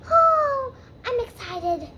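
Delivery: high gushing oh; high excited exclamation. Acted. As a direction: squeal it with glee.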